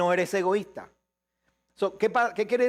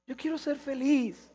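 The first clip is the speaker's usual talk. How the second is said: higher, lightly whiny imitation; mock wishful voice; 7 semitones higher than usual. Preacher mimicking the congregation's wish for happiness, higher and lightly whiny. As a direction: voice the selfish wish like a pouting believer.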